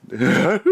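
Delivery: high silly laugh like the little man; imitated funny laugh. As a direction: mimic his goofy laugh. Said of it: "my attempt at laughing like him".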